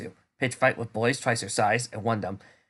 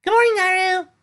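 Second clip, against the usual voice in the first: chirpy greeting; 9 dB louder than usual. Acted. Bright girl greeting friend.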